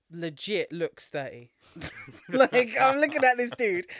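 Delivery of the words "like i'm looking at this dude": high, loud laugh-filled line. Real, not acted. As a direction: laugh through the words in disbelief. Laughing at the actor's looks.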